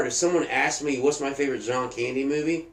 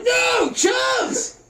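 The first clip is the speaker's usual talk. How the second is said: imitated anguished shout. Retelling Chubbs's fall out the window, imitated anguished shout. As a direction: cry out as if watching a friend fall. Acts out Happy's cry as Chubbs falls.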